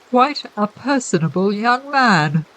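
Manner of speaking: a lightly voiced stuffy-nosed gentleman, slow; odd R's and blocked-nose M's, unhurried; curious accent, head cold. A narrator's reading. Creepy gentleman admires the unconscious bailiff, lightly stuffy and slow. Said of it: "He spoke with a curious accent".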